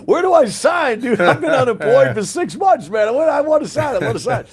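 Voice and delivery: high, loud, laughing eager rush; gleefully grab the job offer; overjoyed. Retold or imitated. Veteran jumping at a job offer, laughing and excited. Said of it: "I was like".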